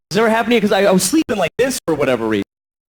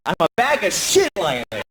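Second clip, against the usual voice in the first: ordinary talk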